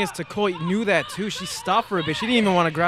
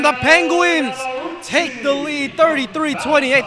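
higher, rising excitement as the lead changes; excited call; 7 semitones higher than usual. Real, not acted. Announcer telling listeners the Penguins take the lead, excited higher call. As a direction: sell the go-ahead bucket. Calling the go-ahead basket.

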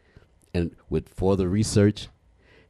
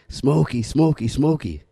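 high call, a little louder; calling the dog; 8 semitones higher, 4 dB louder than usual. Retold or imitated. Boy calling dog that won't come out, high call. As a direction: call the name out toward the porch.

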